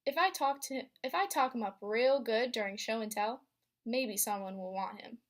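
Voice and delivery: usual speaking voice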